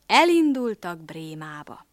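ordinary delivery, nothing marked

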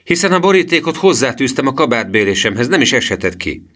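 put-on high, loud boyish protest; Emil's outraged outburst, easing slightly at the end; indignant cry. A narrator's reading. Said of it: "kiáltotta Emil".

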